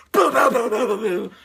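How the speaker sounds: loud, rapid-fire booms; imitated button mashing. She hits the buttons, loud imitated booms. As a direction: percussive vocal sound effect.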